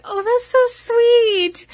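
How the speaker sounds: high, slow gushing coo. Retold or imitated. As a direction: coo it like it melted your heart. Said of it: "I was like".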